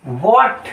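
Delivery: What with lips rounded for the W. Shown as a demonstration. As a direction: round the mouth to begin What. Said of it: "मुंह का pronunciation गोल होना है".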